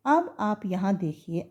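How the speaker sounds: normal talking voice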